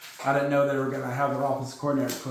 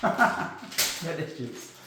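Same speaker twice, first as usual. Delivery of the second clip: drawn out through a chuckle; slow amused echo of the punchline; slow laughing repeat; 4 dB quieter, much slower than usual. Shown as a demonstration. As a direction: let the repeat dissolve into laughter.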